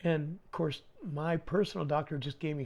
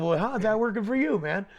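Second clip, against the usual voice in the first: loud, higher replay of the question; unintended smart-ass; 6 semitones higher, 8 dB louder than usual. Retold or imitated. Plumber explaining his first thought, loud unintended smart-ass. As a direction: blurt it out loudly, half amused. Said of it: "didn't mean to be a smart ass".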